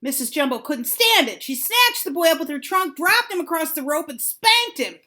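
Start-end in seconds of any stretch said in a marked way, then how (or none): none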